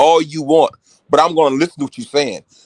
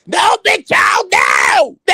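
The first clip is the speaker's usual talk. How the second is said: mock shout; 13 semitones higher, slower than usual. Retelling how he shuts down Mo Moon, mock shouting. Retold shouting down Big Charles.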